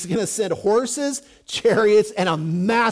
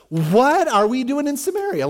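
high, put-on astonished question; mock bewilderment; 6 semitones higher than usual. Soldiers realize they've been duped, mock astonished bewilderment. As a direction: voice jumps up as if suddenly finding oneself lost. Duped army's eyes suddenly open.

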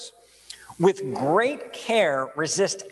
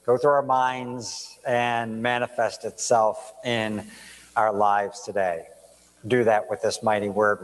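lower, hushed pastoral prayer; earnest low-voiced prayer; 6 semitones lower than usual. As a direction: pray humbly over the congregation. Opening prayer before the sermon.

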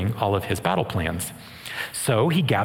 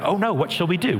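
a touch of panic, pitched up; fearful servant; 6 semitones higher than usual. Retold or imitated. Servant panicking at the army outside, mock fearful cry. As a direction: voice the servant's alarm, lightly. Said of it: "his response is fear".

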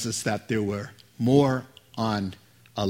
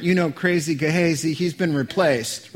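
ordinary talk, nothing marked

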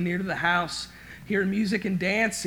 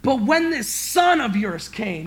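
slow, louder contempt on "son of yours"; bitter accusation; 3 semitones higher, 7 dB louder, slower than usual. Acted. Son accuses father coldly.

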